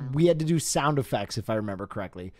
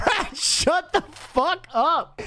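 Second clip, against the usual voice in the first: put-on bratty kid; 10 semitones higher, slower than usual. Echoing the line, laughing.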